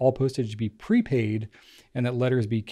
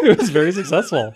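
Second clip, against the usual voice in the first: laughing while repeating it; 5 semitones higher, 7 dB louder than usual. Real, not acted. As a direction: laugh through the words. Laughing echo of cohost.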